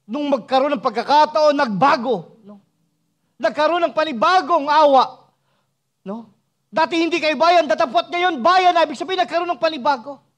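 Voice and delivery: high, loud pulpit proclamation; full-force preaching. Real, not acted. Preacher telling the people they are now God's people, full-force preaching. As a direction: exhort them at the top of the preaching voice.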